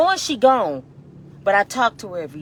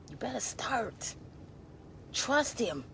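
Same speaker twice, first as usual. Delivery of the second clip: whisper; 4 semitones higher, 10 dB quieter, slower than usual. Acted. Warning unbelievers.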